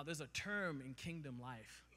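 normal talking voice

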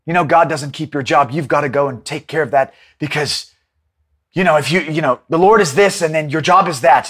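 low, soft tempting whisper of the enemy; enemy's persuasive voice. Retold or imitated. Preacher voicing the enemy to the congregation, low and coaxing. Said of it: "the enemy is telling you".

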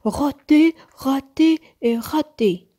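high, loud, emphatic chant of complaint; stubborn repetition. A narrator's reading. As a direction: repeat it crossly, hammering each one. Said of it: "répétait Nook".

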